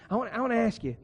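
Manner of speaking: ordinary talk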